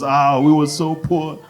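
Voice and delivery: ordinary delivery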